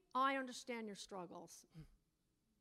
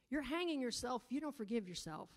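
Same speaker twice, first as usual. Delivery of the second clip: normal talking voice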